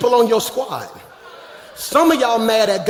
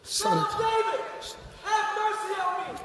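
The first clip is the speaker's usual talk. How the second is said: high but held soft; a far-off cry, pitched up yet quiet; distant shout; 9 semitones higher, 16 dB quieter than usual. Retold or imitated. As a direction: blind man crying from far away, high and soft. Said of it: "He shouts".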